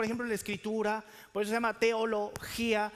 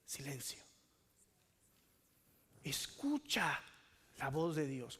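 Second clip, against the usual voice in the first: much softer and lower, almost breathed; hushed, hanging pause; 8 semitones lower, 9 dB quieter, slower than usual. Shown as a demonstration. Preacher to congregation, showing the silence of Selah, hushed. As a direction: let each word fall into silence.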